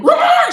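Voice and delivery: high, loud mock crying wail; imitated loud wail. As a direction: let out a hammed-up wailing cry. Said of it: "She's crying really loudly".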